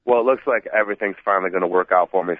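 usual speaking voice

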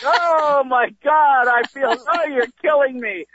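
high, melodramatic mock wailing; hammed-up cries of pain; faked agony. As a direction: overact being beaten to death. Child fakes pain under padded pants.

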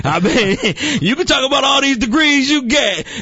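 very high-pitched, laughing exclamation; squealed through amusement; amused outburst. Real, not acted. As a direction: crack up, voice leaping way up. Laughing along after the joke.

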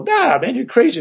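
high-pitched imitated scoffing; mock dismissal. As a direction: wave it off in a high, mimicking voice. Acts out skeptics dismissing him.

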